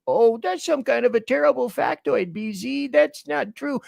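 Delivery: high-pitched mock objection; disbelieving listener. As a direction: imitate a doubter protesting in a high voice.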